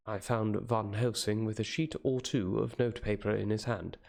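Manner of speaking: ordinary delivery, nothing marked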